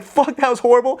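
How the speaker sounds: high-pitched mock exasperation; acted dismay. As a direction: curse a horrible result as the runner. Retold runner dismayed at his time.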